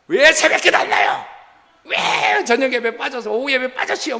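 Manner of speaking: hammed-up telling-off of a truant churchgoer; mock scolding. Acting out a pastor scolding absentees.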